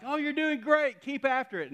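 high, loud mock encouragement; teasing cheer. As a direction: heckle a buddy with hollered praise. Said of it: "harass him some more".

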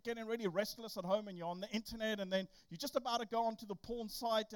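normal talking voice

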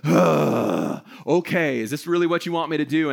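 hammed-up heavy sigh, then grudging compliance; passive aggressive. Husband mimes answering his wife's list, passive-aggressive. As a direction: sigh loudly and agree as a put-upon husband. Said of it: "be a little passive aggressive".

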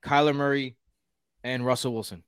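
ordinary talk

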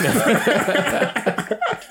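high helpless laugh; burst of laughter. Real, not acted. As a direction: crack up laughing. (fou rire)

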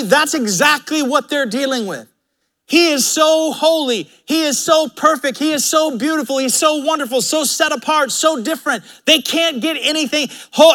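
high-pitched, insistent pulpit delivery; impassioned preacher in full flow; full-force preaching. Acted. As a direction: preach with rising fervour, hammering each phrase.

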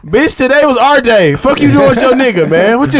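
high-pitched, hammed-up protest; mock indignant. Man confronting his side chick over his day, mock indignant. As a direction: confront them in playful outrage, pitched well up.

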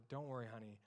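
tender father to frightened daughter; soft reassuring father. Retold or imitated. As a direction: soothe a scared child back to sleep. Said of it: "I get to say back to her".

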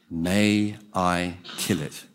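slow, low, deliberate request; solemn angel. Acted. Angel asking the ghost's permission to kill, solemn.

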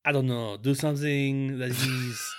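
slow, wobbly attempt at a French accent; muddled French-sounding foreign accent; attempted accent, coming out French. Shown as a demonstration. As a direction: fumble for a Russian accent and land on French. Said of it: "That's French".

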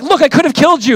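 a preacher voicing David's challenge to Saul; David confronting Saul. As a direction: throw it at him, exasperated. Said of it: "He's like".

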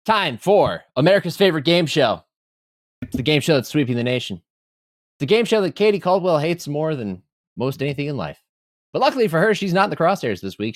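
game show host hype; big TV game show intro; announcer voice. Acted.

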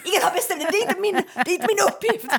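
high mocking voice, laughing; mocking a defensive rant. Teasing her friend by imitating how she rants when stressed. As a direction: parody her indignant protests. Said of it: "du är så här".

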